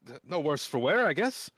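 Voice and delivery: put-on nervous teen gushing thanks; timid boy scout. GM plays the young scout.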